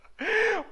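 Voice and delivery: sudden high burst of laughter. Real, not acted. Laughs while testifying.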